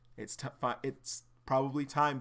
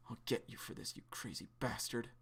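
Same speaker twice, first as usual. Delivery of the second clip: softer, breathy vow of revenge; whispered threat; 9 dB quieter than usual. Acted. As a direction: hiss it under your breath. Beaten Zeke muttering threat.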